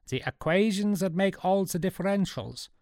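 put-on high-pitched Swiss German old man; eager, high Swiss German voice; greedy Big Nick. A narrator's reading. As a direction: ask hungrily in a Swiss German accent. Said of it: "licking his lips".

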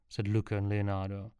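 plain reading voice

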